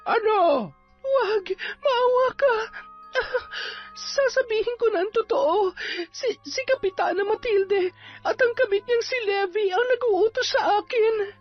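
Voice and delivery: high, crying, desperate begging; pleading in fear. Acted. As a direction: beg for mercy in panic. Witch spirit begs, confesses.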